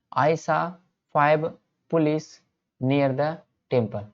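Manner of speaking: very slow, word by word with pauses; slow dictation. Shown as a demonstration. Teacher dictates the sentence very slowly to the class. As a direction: dictate it so students can copy. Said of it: "teacher dictates example sentence slowly".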